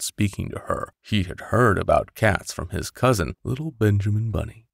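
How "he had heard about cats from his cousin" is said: plain reading voice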